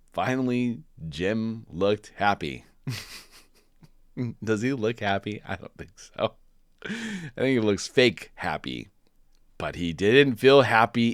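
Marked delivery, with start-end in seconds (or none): none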